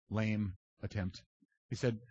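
ordinary delivery

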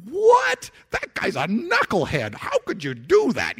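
shocked, mock-scandalized townsfolk; outraged crowd. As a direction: scoff at Jesus in disbelief. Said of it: "the crowd is probably like".

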